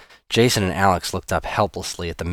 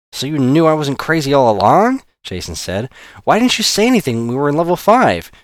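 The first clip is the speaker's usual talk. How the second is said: indignant; 8 semitones higher, 6 dB louder than usual. A narrator's reading. Jason confronts Alex.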